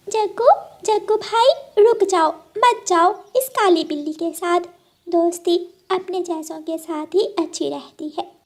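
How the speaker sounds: high urgent calling out; high-pitched bird pleading. Acted. Birds call crow back.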